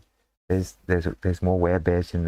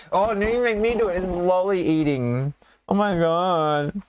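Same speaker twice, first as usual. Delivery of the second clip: hammed-up protest, pitched way up; mock exasperation; 9 semitones higher than usual. GM protests being shamed for candy, mock exasperated.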